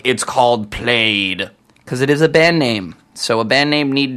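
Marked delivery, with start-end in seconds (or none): 0.0-1.5 slow, "Plaid" said as played; phonetic Plaid. Shown as a demonstration. DJ mocking a listener, says Plaid phonetically. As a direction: spell out "Plaid" slowly as it looks. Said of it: "quite phonetic about it all and be like".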